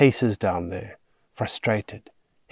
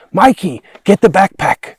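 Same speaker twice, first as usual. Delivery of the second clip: high, loud frantic yell; scream; 10 semitones higher, 11 dB louder than usual. A narrator's reading. Children as the beast growls at them, screaming. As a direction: scream it in panic. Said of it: "Annie screams".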